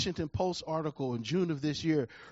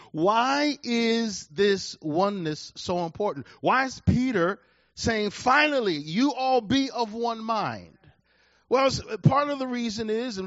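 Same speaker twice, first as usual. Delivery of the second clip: loud, rising pulpit question; a preacher building intensity; fervent preaching; 5 semitones higher, 6 dB louder than usual. Acted. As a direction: press the congregation hard. Preaching at full force.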